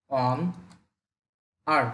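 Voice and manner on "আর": আর short and sharply falling; Chinese fourth tone. Shown as a demonstration. Teacher showing learners a short falling fourth tone. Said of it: "four tone short করে বলতে হবে".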